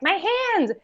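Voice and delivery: high mock screech of a Skeksis in pain. Podcast host retells the Chamberlain's hand wound, high mock screech. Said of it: "and he screeches".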